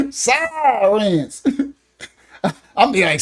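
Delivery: ordinary talk, nothing marked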